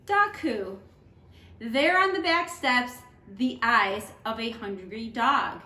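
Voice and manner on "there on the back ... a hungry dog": gentle storybook read-aloud; teacher reading a picture book to students; reading to children. Acted.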